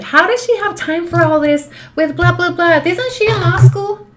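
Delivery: high, loud mocking of a snooping commenter; mock nosy commenter. Mocking a commenter who doubts her time, high and loud. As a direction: sneer as a judgy stranger. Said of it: "I saw somebody comment".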